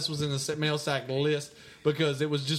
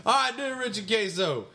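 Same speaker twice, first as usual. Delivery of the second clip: ordinary talk, nothing marked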